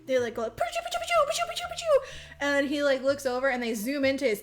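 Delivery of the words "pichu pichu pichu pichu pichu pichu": very high squeaky pichu chirps; squeaky cute creature. Retold or imitated. Retelling the movie to a friend, squeaky creature chirps. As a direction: chirp it like a tiny pocket monster.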